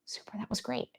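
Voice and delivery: very quiet, light encouragement; soft verbal praise. Acted. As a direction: release your dog with soft, high praise. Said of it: "it's verbal praise".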